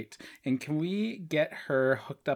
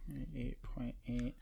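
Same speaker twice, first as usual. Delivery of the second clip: whispered repeat; 14 dB quieter than usual. Real, not acted. Repeating vitals to himself.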